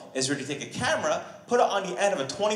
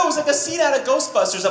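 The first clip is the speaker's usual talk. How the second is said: ordinary delivery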